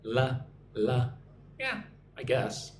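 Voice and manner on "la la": la with the tongue tip on the teeth; touch the teeth with the tongue and say la. Shown as a demonstration. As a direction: dental L demo. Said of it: "Can you say la from your teeth?".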